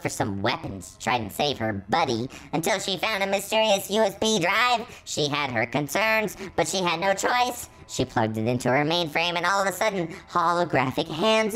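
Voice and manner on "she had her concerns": usual speaking voice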